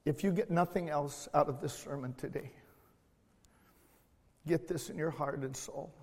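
ordinary talk

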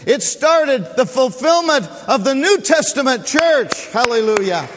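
loud, high preaching shout; full-force preaching. Real, not acted. Pastor preaching loud to his congregation. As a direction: proclaim it to the whole church.

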